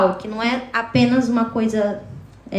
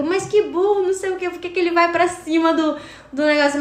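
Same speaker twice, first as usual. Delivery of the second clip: a raised, mocking scoff; mocking exasperation; 6 semitones higher than usual. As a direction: scoff at the dumb horror character. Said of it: "A gente fica". (zombando, exasperada, voz mais aguda)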